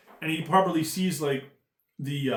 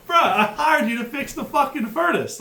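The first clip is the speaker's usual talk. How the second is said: much higher, louder exasperated yell; mock angry yell; 13 semitones higher, 6 dB louder than usual. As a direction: yell at the lazy worker. Angry customer voiced in a story.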